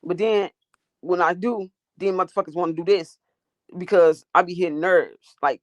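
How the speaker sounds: ordinary talk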